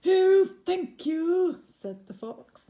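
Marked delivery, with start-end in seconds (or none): 0.1-1.6 high, slow grateful fox; a high little fox, drawn out. Acted. Fox thanking the giant, high and slow.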